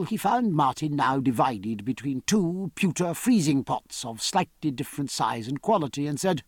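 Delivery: ordinary delivery, nothing marked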